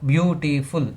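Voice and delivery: beautiful broken into beau-ti-ful; syllable by syllable. Shown as a demonstration. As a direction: say beautiful in three separate beats. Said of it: "Words with more than two syllable".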